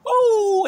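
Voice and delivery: loud, high "ooh"; high whoop. Real, not acted. Whooping after reliving how he chased the man off, high whoop. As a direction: let out a big whoop after the scream.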